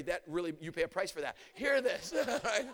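normal talking voice

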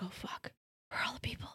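hushed, frightened whisper; scared whisper. Pretend. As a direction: whisper like you're lost in the woods. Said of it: "gonna be like".

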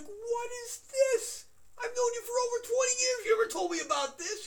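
high, loud outraged amazement; re-enacted shouting disbelief; incredulous protest. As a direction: confront an old friend, voice way up. Acts out confronting his secretive friend.

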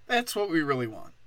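usual speaking voice

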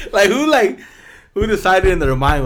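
high, loud disbelieving rant; can't believe anyone made this; incredulous outburst. Real, not acted. Co-host ranting about the gross video, high and loud.

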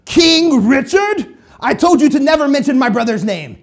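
high, loud indignant outburst in a mock accent; Prince John. Prince rebuking his snake adviser, indignant mock fury. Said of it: "Prince John is like".

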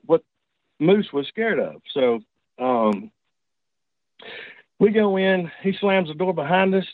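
ordinary delivery

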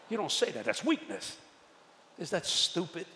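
ordinary talk

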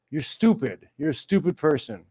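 ordinary delivery

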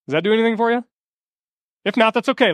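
ordinary delivery

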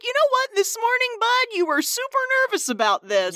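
high, teasing buddy tone; talking to Victor. Retold or imitated. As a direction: needle him like a pal. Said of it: "I'm just like".

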